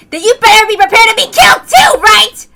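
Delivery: loud, fast, taunting yell; taunting shout. Acted. Rena shouts a threat at the attackers, loud and fast. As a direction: throw the threat down at them.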